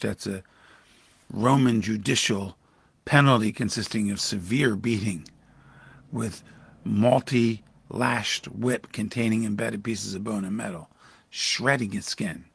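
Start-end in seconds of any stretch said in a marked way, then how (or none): none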